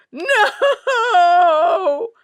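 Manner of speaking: high, loud howl. Acted. Dirty Ulf refusing her mother, loud howl. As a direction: wail in protest. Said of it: "howled Dirty Ulf".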